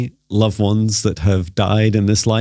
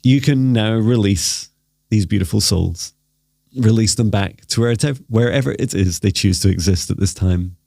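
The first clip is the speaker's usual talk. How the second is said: guided meditation. Acted. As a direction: gently lead them into stillness.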